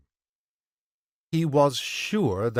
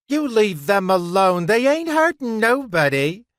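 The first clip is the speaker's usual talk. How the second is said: put-on high-pitched, loud protest for the kids; pleading woman; 10 semitones higher, 6 dB louder than usual. A narrator's reading. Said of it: "the woman pleaded".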